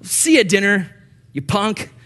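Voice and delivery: high, loud mock parental yelling; harsh parent. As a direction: bark like a cold, punishing parent. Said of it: "It was never just like".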